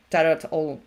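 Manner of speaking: usual speaking voice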